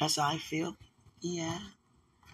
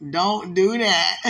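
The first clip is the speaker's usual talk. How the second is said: slow loud plea; 3 semitones higher, 9 dB louder, slower than usual. Retold or imitated. Retold plea, slowed.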